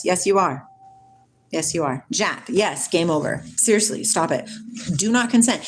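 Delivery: usual speaking voice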